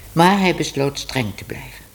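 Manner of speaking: plain reading voice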